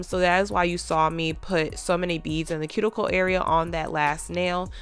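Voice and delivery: ordinary talk, nothing marked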